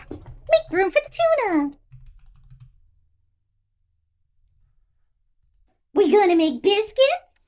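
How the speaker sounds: very high cartoon robot voice; Gir. Streamer quoting a niche cartoon for guessers, high squealing robot. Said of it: "Invader Zim".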